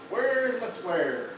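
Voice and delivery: ordinary talk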